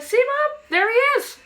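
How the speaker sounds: put-on high, loud, triumphant child; a child proving to Mom that the dog is there; triumphant kid. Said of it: "I turned and went".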